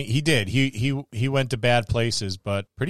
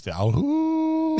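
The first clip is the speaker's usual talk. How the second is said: high, slow eerie ghostly call; Illithid lich call; much slower than usual. Retold or imitated. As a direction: stretch it out like an echoing monster cry. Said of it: "I imagine that being the call of the Illithid lich".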